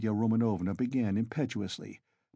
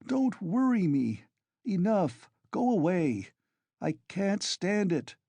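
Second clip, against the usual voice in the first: higher, slower, pleading to be left alone; exasperated; 9 semitones higher, slower than usual. Acted. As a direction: drive them off, at the end of his tether. Sick man sends family away.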